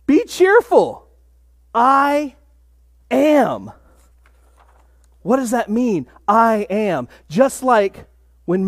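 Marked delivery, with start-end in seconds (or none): 0.1-3.7 ringing proclamation. Retold or imitated. Preacher voicing Jesus calling Be cheerful to the disciples, slow and ringing. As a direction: call it out with joy like a proclamation.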